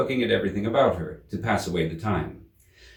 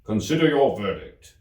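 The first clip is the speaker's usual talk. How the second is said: the king; 4 dB louder than usual. A narrator's reading. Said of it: "the king said to the jury".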